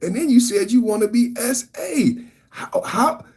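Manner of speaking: how could you, rising up; incredulous. Real, not acted. Incredulous at her messages.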